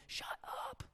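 mock-exasperated whisper; whispered shut up. Tired parent silencing a screaming kid, whispered. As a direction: hiss it under your breath. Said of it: "forgive me".

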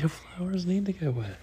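high unvoiced whisper; echoing the child in a whisper. Shown as a demonstration. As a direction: whisper it back softly. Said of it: "echoes child in whisper".

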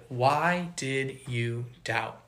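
soft, slow, quiet question; gentle rebuke. Acted. Jesus gently asking sinking Peter, soft and slow. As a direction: ask it gently, slowly, almost under the breath.

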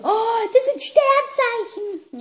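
high, long drawn-out gasp of realisation; mock shock. Imitating her friend finding out about star signs, exaggeratedly shocked. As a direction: imitate her amazed, slow, high-pitched discovery. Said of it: "Sie war sehr schockiert".

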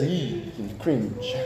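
soft, disgusted "Ee, cringe"; mock cringe. Preacher voicing listeners' reaction to Song of Solomon, mock disgust. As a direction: pull back as if embarrassed. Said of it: "maybe some of you says".

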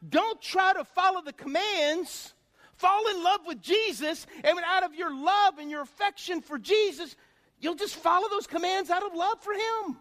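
high, loud revival preaching; preaching at full force. Acted. Pastor urging the congregation to love Jesus, preaching loud. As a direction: exhort the congregation with full fire.

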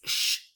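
sh, a clean unvoiced hiss; sh sound. Shown as a demonstration. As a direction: hiss the sh like asking for silence. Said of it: "Que suene bien sh de".

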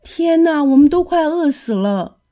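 high, loud wail of despair; despairing cry. Acted. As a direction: cry out that you're starving. 小鸡绝望地叫喊. (高声绝望地哭喊)

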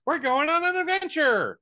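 high, loud, eager little mushroom voice; spunky little Toad. Retold or imitated. Co-host quoting Toad's big line, high and loud. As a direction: squeak it out like a tiny hyped-up sidekick. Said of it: "this itty-bitty little ball of spunk".

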